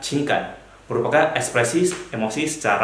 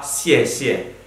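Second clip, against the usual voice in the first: each syllable laid out slowly; slow careful Mandarin. Shown as a demonstration. Teacher introduces topic name to class, slow and clear. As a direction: read the Chinese term slowly for learners. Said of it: "Contohnya berupa".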